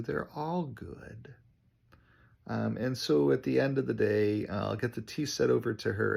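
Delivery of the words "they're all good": soft reassurance. Retold or imitated. Reassuring retired client.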